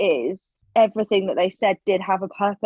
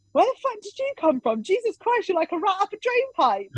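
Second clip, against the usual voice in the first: high-pitched, incredulous retold exclamation; mock astonishment; 11 semitones higher than usual. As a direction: act out being startled, voice way up high. Retold instructor's startled exclamation.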